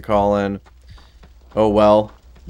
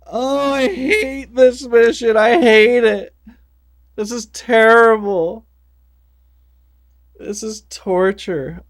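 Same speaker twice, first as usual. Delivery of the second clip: very high, slow hammy whine; whiny voice; 14 semitones higher, 5 dB louder, slower than usual. Pretend. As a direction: whine it like a cartoon. Whiny high voice about the mission.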